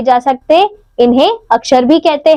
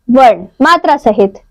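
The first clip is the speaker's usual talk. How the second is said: ordinary talk, nothing marked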